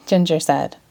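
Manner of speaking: ordinary delivery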